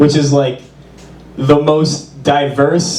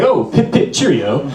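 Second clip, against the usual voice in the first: put-on posh transatlantic accent. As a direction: toss off a posh transatlantic greeting. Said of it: "somebody on the street passed me by and said".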